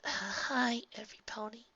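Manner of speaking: soft greeting. A narrator's reading. Twilight answers softly.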